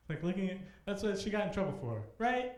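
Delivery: bursting with disbelief at the reason; incredulous. Retold or imitated. Telling the crowd about the principal's complaint, incredulous and loud.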